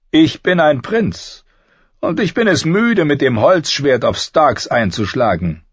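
high-pitched haughty character voice; haughty prince Joffrey. A narrator's reading. Prince refusing wooden swords, high and haughty. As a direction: speak down to them in a high princely voice.

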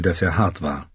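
plain reading voice

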